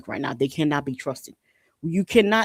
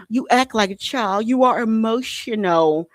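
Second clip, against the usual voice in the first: mocking condescension; 5 semitones higher, 4 dB louder, slower than usual. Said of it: "they go".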